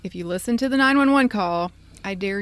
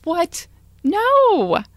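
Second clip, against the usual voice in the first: incredulous; 9 semitones higher, 6 dB louder than usual. Real, not acted. Outraged disbelief.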